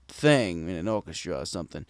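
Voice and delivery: ordinary delivery, nothing marked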